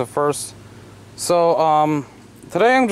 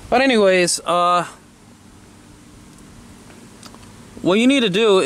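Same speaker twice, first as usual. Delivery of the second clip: raised voice over the wind; shouting over wind; 4 semitones higher than usual. Real, not acted. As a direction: talk loud to carry over wind. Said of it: "so I'm gonna talk loud so you can hear me over the wind".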